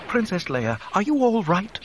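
high-pitched, fussy worrying; Threepio fretting. A narrator's reading. Droid checking on the hurt princess, fretting. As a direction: fret anxiously, pitch climbing right up. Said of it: "three P O fretted".

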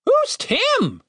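very high raven voice; squawky high bird question; Ravenous. A narrator's reading. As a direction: ask as a high-pitched talking bird. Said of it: "asked Ravenous".

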